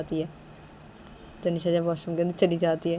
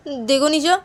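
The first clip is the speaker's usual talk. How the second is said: upset warning; 9 semitones higher, 10 dB louder, slower than usual. Acted. Acted quarrel with Nisha.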